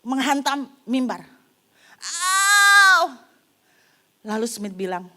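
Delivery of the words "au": loud, high cry of pain; pained yell. Retold or imitated. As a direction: yelp as if hit hard. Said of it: "Wanita ini teriak". (teriakan kesakitan)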